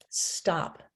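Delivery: voiceless stop; unvoiced "stop"; whispered word. Shown as a demonstration. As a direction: say "stop" on breath only.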